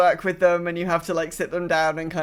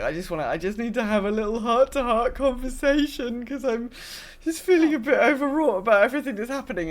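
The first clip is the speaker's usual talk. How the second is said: higher, pleading companion voice; higher, put-on overwrought voice; needy companion; 4 semitones higher than usual. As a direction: play a fretful companion oversharing their feelings. Voicing companion wanting a heart-to-heart.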